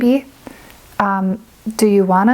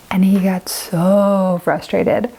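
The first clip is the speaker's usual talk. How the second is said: slow, hushed aside; whisper; much slower than usual. Real, not acted. Telling viewers of her frustrated husband, in a slow whisper. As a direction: drop to a confiding whisper. Story of husband's failed bake.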